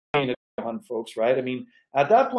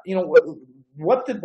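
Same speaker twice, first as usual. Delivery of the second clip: usual speaking voice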